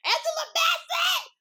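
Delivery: very high, loud, drawn-out shriek; fangirl screaming. Retold or imitated. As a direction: scream the star's name right at her. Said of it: "we are screaming in her face".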